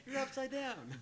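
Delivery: high-pitched, loud mock exasperation; mock scolding call. Teacher to a kid doing a handstand, mock scolding. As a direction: holler it at the kid, playing it for laughs.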